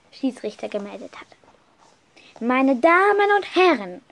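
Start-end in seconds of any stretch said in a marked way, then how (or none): 2.4-4.0 höher und lauter ausgerufen, wie ein Ansager; Ansage gerufen. Retold or imitated. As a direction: ruf es ins Stadion wie ein Schiedsrichter. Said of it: "rief er". (Schiedsrichter begrüßt das Stadion, lauter und höher ausgerufen)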